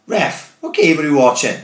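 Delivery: retelling shouting at the ref, high and loud; reenacting yelling at the referee over a sending off, outraged shout; mock outraged yell. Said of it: "I turned to the fucking ref and I goes".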